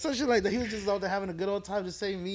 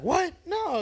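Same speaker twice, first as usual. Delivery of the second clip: much higher squeaky mock disbelief; mock baffled; 13 semitones higher than usual. Comic answering a heckler, mock-baffled high squeak. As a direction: play the comic caught off guard, pitched way up.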